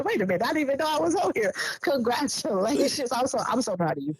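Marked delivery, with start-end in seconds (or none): 0.0-1.5 high, fast surprise; surprised mock voice. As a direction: act amazed at finding yourself on air.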